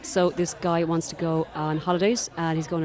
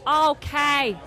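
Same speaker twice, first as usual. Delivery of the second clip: put-on Dublin accent; 9 semitones higher, 4 dB louder than usual. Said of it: "so I'm gonna use a Dublin accent".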